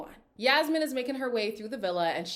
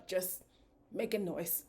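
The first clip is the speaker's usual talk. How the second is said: softer, muted aside; understated; 7 dB quieter than usual. Real, not acted. Host on the noisy couple, understated. First take, said low.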